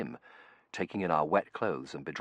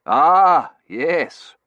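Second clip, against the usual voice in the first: put-on old professor voice; 11 semitones higher, 16 dB louder than usual. Professor character voice.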